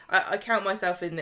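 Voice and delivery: ordinary talk, nothing marked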